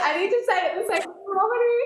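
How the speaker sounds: high and fast, laughing through the words; laughing mock plea. Retelling her ritual panic while cracking up, laughing. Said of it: "I was like".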